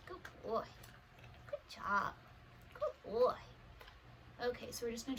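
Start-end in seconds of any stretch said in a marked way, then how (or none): 0.1-3.3 dog praise. Real, not acted. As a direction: pitch it way up for the dog.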